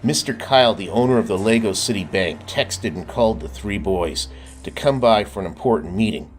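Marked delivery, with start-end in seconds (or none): none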